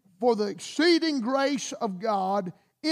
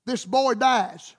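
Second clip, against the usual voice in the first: ordinary talk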